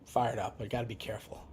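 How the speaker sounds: whispered to himself, cooling off; a muttered warning to self; hushed self-reminder. Real, not acted. Checks himself mid-rant.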